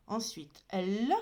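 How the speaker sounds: usual speaking voice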